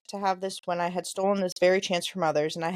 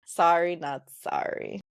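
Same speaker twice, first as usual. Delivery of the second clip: cheeky sorry not sorry; 4 semitones lower, slower than usual. Real, not acted. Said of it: "teasing listeners about the cliffhanger".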